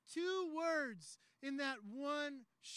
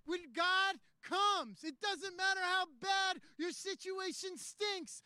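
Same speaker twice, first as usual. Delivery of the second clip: higher, louder, emphatic pulpit delivery; rousing preaching climax; 6 semitones higher, 5 dB louder than usual. Acted. Preacher promising God will do the heavy lifting, rousing and forceful. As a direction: drive it home to the whole room.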